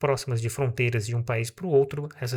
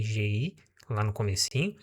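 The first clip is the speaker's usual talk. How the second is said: ordinary delivery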